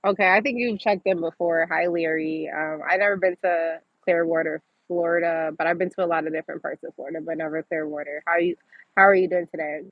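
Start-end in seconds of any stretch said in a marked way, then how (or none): none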